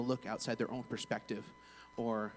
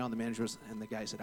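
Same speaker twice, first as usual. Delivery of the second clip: ordinary talk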